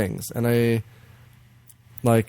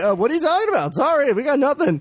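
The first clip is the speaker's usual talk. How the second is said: high-pitched feigned ignorance; mock dismissive game; 12 semitones higher, faster than usual. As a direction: brush them off in a silly high voice. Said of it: "It's like".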